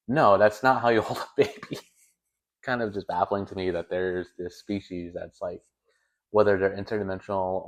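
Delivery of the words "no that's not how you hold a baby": exasperated. Retold or imitated. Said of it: "And David's like".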